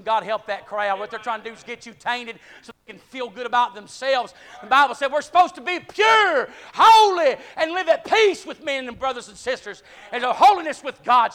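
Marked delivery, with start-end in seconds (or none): 4.6-11.3 loud, high preacher build on holy; preacher swells to full voice; rising preaching. Acted. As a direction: ring out the holy.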